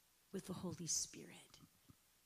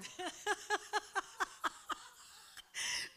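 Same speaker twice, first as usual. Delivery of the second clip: laughing; 13 semitones higher than usual. Real, not acted. Laughs at her own story.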